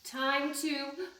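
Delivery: plain reading voice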